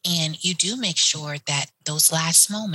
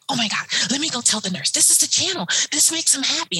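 higher, fast and thrilled; super excited; 8 semitones higher, faster than usual. Retold or imitated. Aide who found the patient's favorite station, excited and fast. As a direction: burst out with delight, rushing. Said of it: "I'm super excited".

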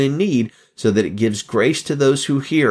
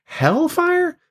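very high-pitched disbelieving echo; incredulous. Acted. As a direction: squeak it back as an astonished question. Mock objection to Jesus's warning.

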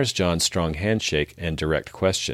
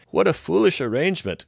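scornful alien ruler; 6 semitones higher than usual. Acted. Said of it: "causes an outburst of laughter from the ruler".

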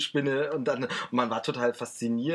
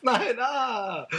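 mock scream; 11 semitones higher, 4 dB louder than usual. Mock horror joke about axe.

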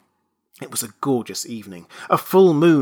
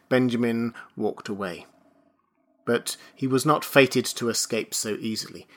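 plain reading voice

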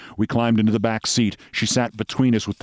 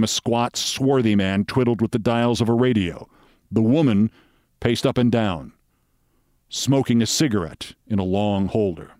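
ordinary delivery

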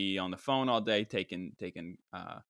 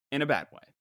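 ordinary talk

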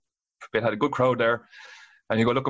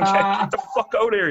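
frantic mock yell; 8 semitones higher than usual. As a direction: shout it like he is freaking out. Alan freaking out.

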